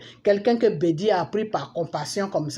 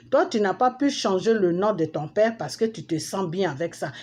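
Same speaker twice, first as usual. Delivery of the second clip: usual speaking voice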